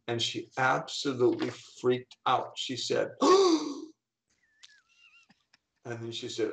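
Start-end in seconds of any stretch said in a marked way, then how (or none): 3.1-3.8 sharp high gasp of disbelief; mock startled gasp; imitated gasp. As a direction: gasp like the shocked student. Student sees her A grade.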